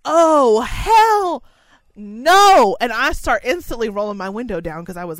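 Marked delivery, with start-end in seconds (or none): 0.1-2.8 loud, higher oh hell no; rising outrage, loud and sharp; indignant outburst. Retold or imitated. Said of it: "And I was like".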